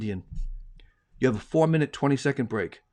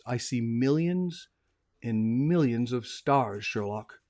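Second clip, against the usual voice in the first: slow, low, awed Watson; awed hush; 6 semitones lower, 9 dB quieter, slower than usual. Acted. Watson telling Sherlock of the stars, slow and awed. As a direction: gaze up at the stars in wonder.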